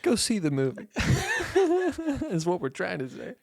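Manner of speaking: laughing through the words. Real, not acted. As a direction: giggle while you say it. Laughing apology to listeners.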